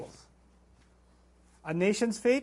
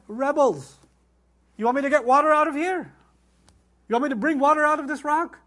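louder and higher, exasperated; exasperated rebuke; 6 semitones higher, 5 dB louder than usual. Retold or imitated. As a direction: scold the people as a fed-up Moses. Moses' exasperated words in the Torah.

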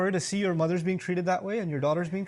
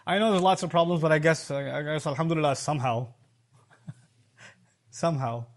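mock reluctant, trailing down in pitch; half-hearted thanks; 4 semitones lower than usual. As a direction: say the thanks without feeling it, sinking away. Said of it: "tongue is saying something the heart isn't feeling".

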